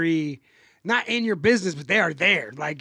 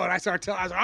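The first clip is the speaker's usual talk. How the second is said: ordinary delivery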